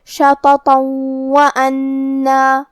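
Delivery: شَطَطًا وَأَنَّا joined by a long nasal doubled w; idgham with ghunnah. Shown as a demonstration. Teacher joining two verses, nasal merge of شَطَطًا into وَأَنَّا. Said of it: "تنوین کو واو میں ملا کے غنہ اور تشدید کے ساتھ".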